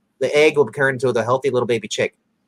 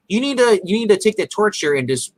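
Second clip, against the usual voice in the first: urgent; 8 semitones higher than usual. Retold or imitated. Quoting Harry's urgency.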